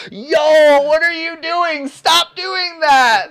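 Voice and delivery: high shrieking yell, stretched slow on the last words; a high, loud mock shriek of horror; horrified crowd. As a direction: scream at them to stop in shock. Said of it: "And everybody's like".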